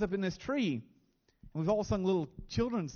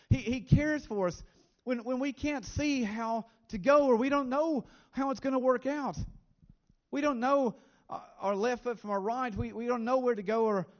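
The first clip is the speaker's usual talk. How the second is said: higher, louder preacher's appeal; impassioned preaching; 6 semitones higher, 3 dB louder than usual. Real, not acted. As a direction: lift them up with fervent conviction. Preaching with rising intensity.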